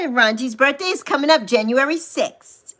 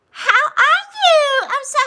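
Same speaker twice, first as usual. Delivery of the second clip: excited squeal; 13 semitones higher, 3 dB louder than usual. Real, not acted. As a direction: squeal hello at your bestie. Greeting best friend.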